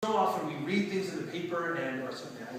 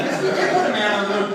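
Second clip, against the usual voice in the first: louder and faster, laughing insistence; half-laughing protest against doubters; insisting; 5 semitones higher, 12 dB louder, faster than usual. Retold or imitated. Volunteer defending the moon landing to kids, insisting.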